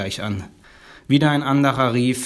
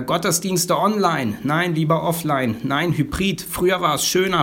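mock crowd calls; 6 semitones higher than usual. Church members arguing over services, mild higher-pitched calls. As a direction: voice rival opinions on a raised pitch. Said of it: "rufen manche".

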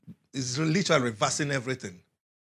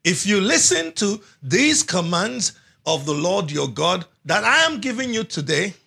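ordinary delivery

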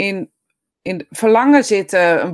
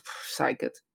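unvoiced, much softer scoffing brush-off; dismissive doctor; 8 semitones lower, 13 dB quieter, slower than usual. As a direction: wave it away like an annoyed doctor. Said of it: "die orthopeed zegt". (minachtende orthopeed)

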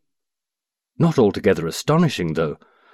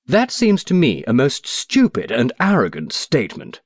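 higher-pitched indignant outburst; angry cry; 6 semitones higher than usual. Acted. Cobthorne angrily rebuking the stranger, raised indignant cry. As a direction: fling the insult at him, affronted. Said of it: "he cried angrily".

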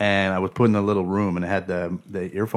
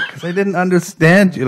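laughing; 8 semitones higher, 4 dB louder than usual. Retold or imitated. Answering the tester.

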